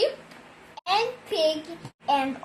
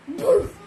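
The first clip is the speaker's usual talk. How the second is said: sudden loud high boof; 6 semitones higher, 6 dB louder than usual. Acted. Sheep blasting a boof into dog's ear, loud. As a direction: shout the boof right at his ear. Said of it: "Sheep went up to dog and went".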